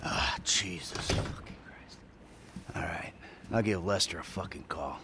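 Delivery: low, quiet, deflated mutter; weary resignation. Acted. Deciding to call Lester after the row, resigned. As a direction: sigh it out under your breath, giving in. Alone after rant, gives in.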